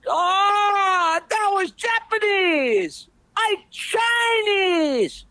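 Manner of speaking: put-on high, very slow, wailing Chinese accent. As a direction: drag it out in a mock Chinese accent. Chinese guy protests.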